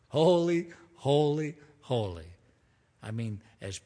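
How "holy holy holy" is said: rising exclamation, falling away; awed cry. Acted. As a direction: cry it out like the angels, then let it fade. Seraphim crying out, acted.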